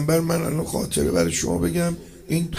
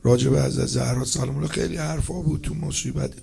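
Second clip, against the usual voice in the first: ordinary delivery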